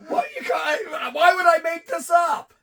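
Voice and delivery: why would I lie, loudly; indignant shout. Real, not acted. Host protests to his co-host, shouting indignantly. Heated argument over the story.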